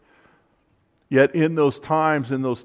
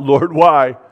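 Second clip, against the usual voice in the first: crying out; 4 semitones higher, 5 dB louder than usual. Retold or imitated. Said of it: "David cried out".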